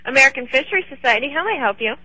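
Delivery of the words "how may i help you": normal talking voice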